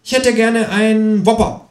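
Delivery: hohe, freche Tierfigur bestellt; Känguru-Stimme. Acted. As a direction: bestell keck als Känguru. (hohe, freche Känguru-Stimme)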